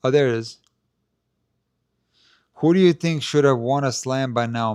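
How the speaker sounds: ordinary talk, nothing marked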